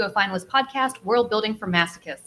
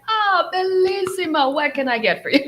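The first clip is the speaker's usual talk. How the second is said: higher, drawn-out cheerful Italian greeting; happy Italian waiter; 10 semitones higher, 5 dB louder, slower than usual. Retold or imitated. Italian waiter welcoming a tourist who tried Italian, cheerful. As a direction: beam at the tourist like a delighted waiter. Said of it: "they'll just be like".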